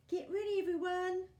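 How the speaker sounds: rally the ducks with a big shout. A narrator's reading. Gumboot shouting to all the ducks before throwing, loud. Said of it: "he shouted".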